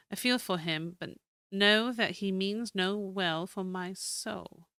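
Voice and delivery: steady, storytelling reading of a keeper's journal; diary read aloud. Acted. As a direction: read the old journal like a tale. Reads diary entries aloud.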